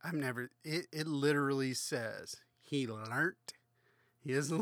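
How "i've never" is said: ordinary talk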